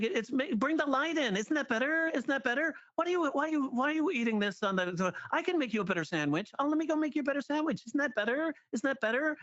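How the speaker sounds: high cloying girlfriend imitation; clingy girlfriend voice. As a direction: coax him like a smothering partner. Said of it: "act out of a girlfriend who won't shut up".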